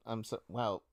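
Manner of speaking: ordinary delivery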